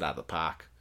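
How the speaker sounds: put-on light Boston accent on the ending. Podcaster praising a game, light Boston accent. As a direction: slip into a Boston accent, dropping the R.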